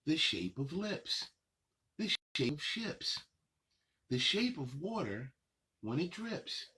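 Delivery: sing-song picture book reading, unhurried pauses; reading to children. Acted. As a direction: read the rhymes to little kids, savoring each line. Reads picture book aloud.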